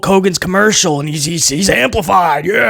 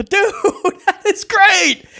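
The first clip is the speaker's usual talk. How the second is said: laughing; 12 semitones higher, much slower than usual. Real, not acted. Laughing at the joke.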